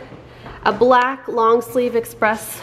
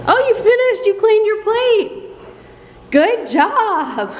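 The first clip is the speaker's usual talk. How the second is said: sing-song praise, much higher; 10 semitones higher than usual. Real, not acted. Praising toddler in high voice.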